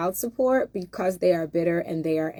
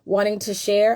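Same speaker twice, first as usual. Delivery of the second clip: ordinary talk, nothing marked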